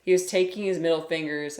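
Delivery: ordinary delivery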